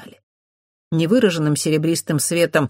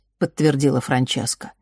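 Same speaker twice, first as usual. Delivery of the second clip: ordinary delivery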